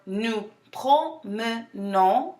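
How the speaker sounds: "nous promenons" very slowly, syllable by syllable. Shown as a demonstration. Teacher for students repeating after her, slow syllable breakdown. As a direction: separate each syllable of "promenons" with small gaps. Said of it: "break it down slower".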